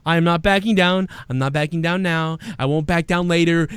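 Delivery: ordinary delivery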